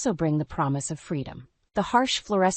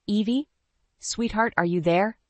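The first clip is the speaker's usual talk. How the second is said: mother voice; 5 semitones higher than usual. A narrator's reading. Mother's worried voice on phone.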